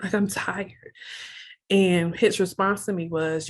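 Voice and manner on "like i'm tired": softer, voiced imitation of whispering; retold whisper. Wife to husband during a hug, retold soft whisper. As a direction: recall a hushed confession, dropping the volume. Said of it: "I just whispered to him".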